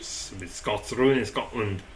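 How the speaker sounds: low, lightly cartoonish Scottish voice; Simpsons Scottish accent. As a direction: grumble in an amateur Scottish accent. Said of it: "do the Simpsons Scottish".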